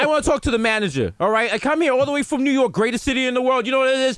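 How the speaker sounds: high, shouting entitled New Yorker; mock tirade. Entitled New Yorker demanding the manager, a rising mock yell.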